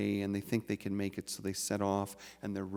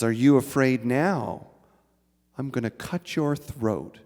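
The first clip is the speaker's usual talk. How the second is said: loud, hard demand with a knife raised; wife holding a knife to her husband's throat, threatening loudly; fierce threat; 2 semitones higher, 8 dB louder than usual. Acted. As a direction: push the threat home, loud and sharp. Wife repeats the threat louder.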